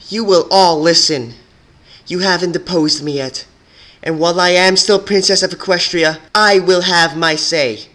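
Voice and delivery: commanding shout. A narrator's reading. Twilight to the rebels who would depose her, furious shout. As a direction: shout them down with full authority. Princess commanding silenced prisoners.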